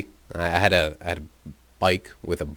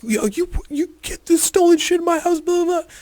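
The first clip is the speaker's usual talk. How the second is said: far higher, louder furious outburst; livid yell; 6 dB louder than usual. Retold or imitated. Andrew yelling about stolen goods in his house, livid. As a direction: squeal it out in outrage.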